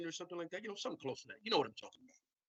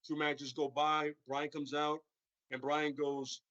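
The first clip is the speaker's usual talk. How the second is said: normal talking voice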